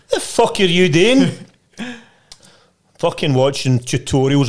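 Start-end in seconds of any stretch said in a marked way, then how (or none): 0.1-1.3 raised, higher, slower call to the next room; a hammed-up yell at his missus; mock shout through the wall. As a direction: shout it through to the other room, half-laughing. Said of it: "I would shout through to her".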